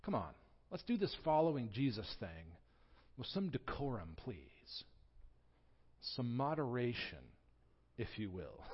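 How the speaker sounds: softly fussy, prim plea for decorum; mild mock-prissy disciple voice; prim disapproval. Disciples wanting decorum at dinner, a hint of prim disapproval. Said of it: "they sort of think".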